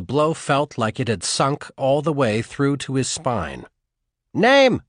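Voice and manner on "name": loud high shout; barked demand. A narrator's reading. Soldier shouts at the prisoner again, loud bark. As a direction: hammer the question again.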